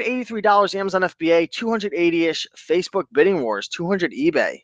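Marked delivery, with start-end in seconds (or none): none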